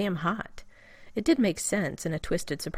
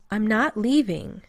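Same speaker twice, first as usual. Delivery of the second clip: louder, slow, sulky refusal; petulant; 4 semitones higher, 7 dB louder, slower than usual. Acted. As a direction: dig in like a tired, stubborn child. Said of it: "that was Rodney's petulant voice".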